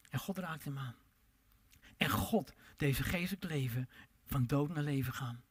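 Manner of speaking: hushed whisper. Acted. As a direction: breathe it out in awe, no voice. Awe at God's touch.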